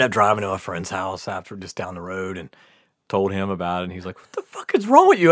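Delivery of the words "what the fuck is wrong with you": high, loud incredulous question; mock disbelief. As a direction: imitate an appalled friend. Friend reacting to his story.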